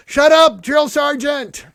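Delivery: high, loud yell, slow on drill sergeant; yelled in unison. Retold or imitated. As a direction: yell it like sixty privates together. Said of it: "sixty privates yelled in unison".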